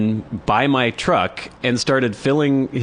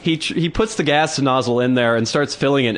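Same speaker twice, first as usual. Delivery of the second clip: normal talking voice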